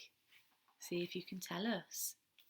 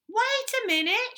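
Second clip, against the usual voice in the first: loud, high shout; 11 dB louder than usual. Acted. Baby Owl shouts at the pushing chicks, exasperated. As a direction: yell to stop the crowd. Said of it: "shouted Baby Owl".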